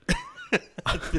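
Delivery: helpless high laugh; squeaky cackle; high burst of laughter. Real, not acted. Cracking up at his joke.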